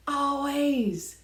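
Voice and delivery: loud, high Always; emphatic always. Real, not acted. Repeated emphatic word.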